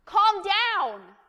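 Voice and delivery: loud, high-pitched acted shout; parent's yell. Adult at a kid having a tantrum, sudden yell. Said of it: "to yell at a kid".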